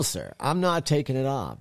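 ordinary talk, nothing marked

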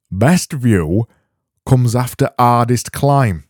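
broad, hammed-up Yorkshire accent; best Yorkshire accent. Host reading listeners a quote, best Yorkshire accent. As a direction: put on your thickest Yorkshire for a motto. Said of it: "do it in my best Yorkshire accent".

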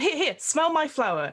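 high eager clown offer; prop comic voice. Acted. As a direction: push the flower, eager. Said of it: "prop comic".

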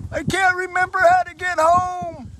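high-pitched wailing punchline; mock crying. Old man confessing to the pastor why he cries, hammed-up sobbing. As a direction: wail it like a bawling old man. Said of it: "he starts crying again".

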